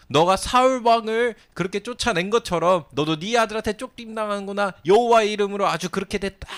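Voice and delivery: high, loud gloating curse; cursing taunt. Retold or imitated. Stone thrower cursing the fleeing king, high and loud. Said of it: "이러면서 막 돌 던진 사람이 있었어요".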